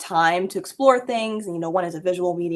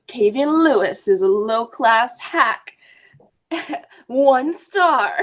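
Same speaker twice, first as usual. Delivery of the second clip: slow supervillain voice, louder; megalomaniac rant; 5 semitones higher, 6 dB louder, much slower than usual. Retold or imitated. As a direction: read it like a gloating villain. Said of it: "in, like, a supervillain voice".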